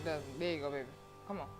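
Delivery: ordinary talk, nothing marked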